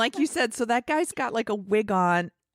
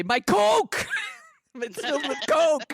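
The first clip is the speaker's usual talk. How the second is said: mock movie shout; 8 semitones higher than usual. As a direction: yell it like a cheesy action villain. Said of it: "Running Scared would say".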